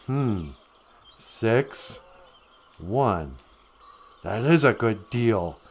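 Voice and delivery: mock-serious deliberation; pondering. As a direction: weigh it up word by word, then be won over. Jack slowly weighs up the offer.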